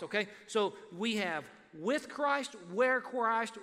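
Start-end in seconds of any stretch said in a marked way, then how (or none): 0.5-2.5 "with" pressed slow and firm; stress on with. Shown as a demonstration. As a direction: lean on "with" in "with Christ". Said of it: "The emphasis is clearly on".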